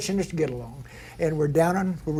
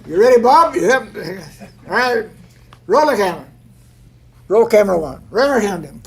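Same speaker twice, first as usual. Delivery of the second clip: loud, raised film-set call; 4 semitones higher, 8 dB louder than usual. Retold or imitated. Director cues driver and cameras before a stunt, calling out. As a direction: call it out loudly to the crew.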